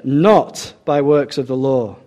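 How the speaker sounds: slow, weighty stress on not; "not by works of the law" pressed slow and firm; emphatic. Shown as a demonstration. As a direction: lean hard on "not" and draw the phrase out slowly. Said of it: "Emphatic here".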